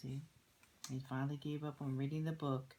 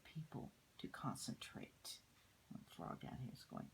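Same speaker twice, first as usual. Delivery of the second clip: much softer unvoiced whisper; 11 dB quieter than usual. A narrator's reading. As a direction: trail into a whisper. Narration dropping to a hush.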